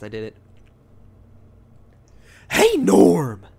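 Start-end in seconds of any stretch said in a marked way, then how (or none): 2.5-3.5 loud, higher put-on old announcer voice; Harry Carey impression. Asked to show the impression, a loud Harry Carey voice. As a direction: bellow it like a goofy baseball announcer. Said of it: "Will Ferrell impersonating Harry Carey impression".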